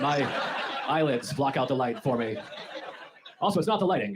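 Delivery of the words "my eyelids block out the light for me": slow, deliberate explaining with a laugh. Acted. Spelling it out slowly to the buddy offering a sleep mask. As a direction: spell it out slowly like it's obvious. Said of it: "I have a built-in sleep mask called eyelids".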